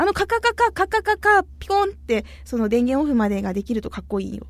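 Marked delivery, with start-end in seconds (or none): 0.0-2.2 clicky button noises. Retold or imitated. Imitates controller button clicks.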